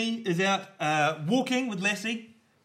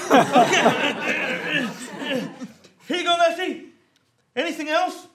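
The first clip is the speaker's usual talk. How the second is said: mock farmer; 11 semitones higher, 7 dB louder, slower than usual. Farmer feeding the cat.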